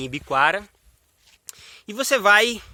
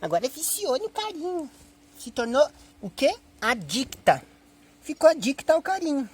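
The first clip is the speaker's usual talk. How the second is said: teasing, high doting voice; pet baby talk; 7 semitones higher than usual. Pretend. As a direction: coo to a cuddle-hungry dog. Brincando com cachorro que pede carinho. (voz aguda de quem mima cachorro)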